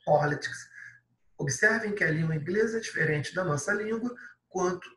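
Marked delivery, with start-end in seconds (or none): 0.0-0.7 politics with stress on po; English pronunciation. Shown as a demonstration. Teacher repeats politics after warning about pronunciation. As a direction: say politics clearly for students. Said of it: "Cuidado com a pronúncia".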